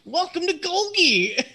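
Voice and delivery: high, loud, laughing welcome; talking rock. Acted.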